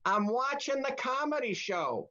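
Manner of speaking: put-on high-pitched old woman calling out. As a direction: imitate an old lady announcing it in a high singsong. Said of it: "you heard this old woman going".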